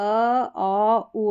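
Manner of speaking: normal talking voice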